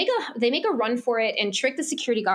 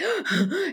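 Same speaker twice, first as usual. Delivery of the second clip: imitated breathless gasp; heavy breathing; 6 semitones higher than usual. Girl running from guards, acted heavy gasping. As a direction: gasp as if struggling for air. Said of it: "breathing really heavy".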